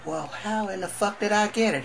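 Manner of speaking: alarmed question. Acted. Patient asks doctor, alarmed.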